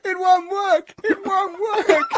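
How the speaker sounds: high, hammed-up whine, dragging out the end; mock despairing wail. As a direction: wail it in comic defeat. Imagined nun despairing at failed tricks.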